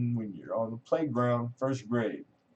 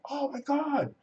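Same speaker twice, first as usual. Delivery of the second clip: mock-horrified gasp, pitched way up; high, drawn-out "oh my God"; movie-style alarm; 3 dB quieter, slower than usual. Bystander in a movie spotting a dying man, hammed-up alarm. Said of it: "you see the T V and the movies and shit".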